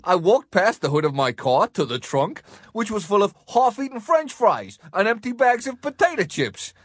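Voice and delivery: exaggerated American accent with jumpy, rising pitch; hammed-up, badly faked American. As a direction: attempt an American accent and overdo it. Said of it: "for us to practice American accents with".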